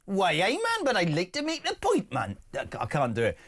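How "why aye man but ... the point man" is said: high-pitched attempt at a Geordie accent; bad Newcastle accent. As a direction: put on an exaggerated Geordie, pitched well up. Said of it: "like, a Newcastle accent".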